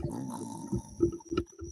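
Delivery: a soft, breathy mock snore of sleeping animals; imitated snore. Telling the host how the sleeping characters sound, a soft mock snore. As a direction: snore like everyone's dozing after lunch. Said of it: "all you can hear is".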